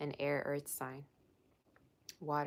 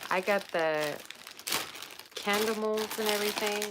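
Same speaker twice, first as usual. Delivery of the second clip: excited; 5 semitones higher, 5 dB louder than usual. Real, not acted. Showing the gifts.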